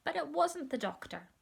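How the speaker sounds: ordinary delivery, nothing marked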